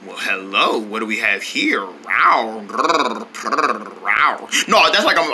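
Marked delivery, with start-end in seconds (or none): 0.1-2.6 low, soft suave greeting; flirty purr. Pretend. Tiger falling in love, low flirty murmur. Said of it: "I'm falling in love with somebody". 2.7-4.5 low rolled purring grrr; soft tiger growl. Acted. Smitten tiger, soft low growl.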